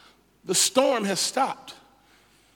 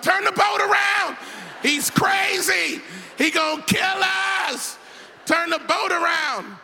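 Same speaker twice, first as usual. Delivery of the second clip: panicked yelling; 8 semitones higher than usual. Acted. Panicked disciples on the boat.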